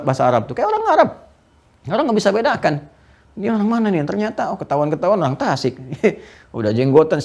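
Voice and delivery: ordinary talk, nothing marked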